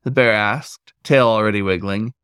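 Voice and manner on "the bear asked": ordinary delivery, nothing marked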